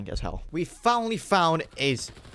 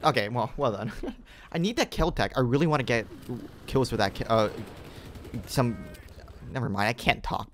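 normal talking voice

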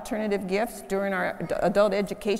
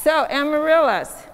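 put-on higher, louder, bright children's-sermon voice; talking to children; 4 semitones higher, 4 dB louder than usual. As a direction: welcome the little ones with delight. Children's time with kids up front.

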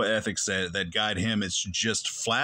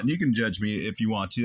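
usual speaking voice

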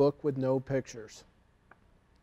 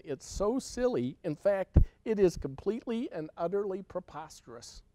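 ordinary delivery, nothing marked

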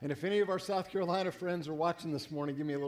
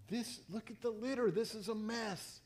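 mock disgust; 4 semitones higher, 5 dB quieter than usual. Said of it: "I'm thinking".

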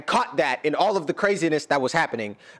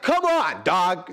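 excited exclamation; 3 semitones higher, slower than usual. Real, not acted. Exclaiming at the song.

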